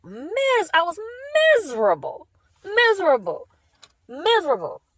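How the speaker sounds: the word keeps breaking upward, close to tears. Real, not acted. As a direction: pitch jumps high as the voice cracks on each miserable. Despair over church attendance.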